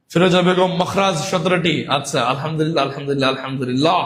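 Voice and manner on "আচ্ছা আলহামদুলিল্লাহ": ordinary delivery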